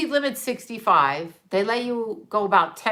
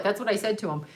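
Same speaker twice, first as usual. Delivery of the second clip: ordinary talk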